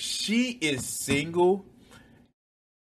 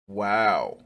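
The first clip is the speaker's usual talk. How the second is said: ordinary talk, nothing marked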